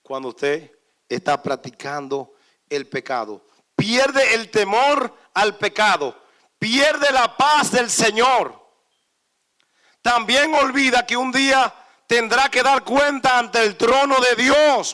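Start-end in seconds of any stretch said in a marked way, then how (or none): 3.7-14.9 loud, high sermon cadence; fiery preacher, slow and emphatic at the end; full-force preaching. Real, not acted. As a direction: preach it hard, slowing on each pierde.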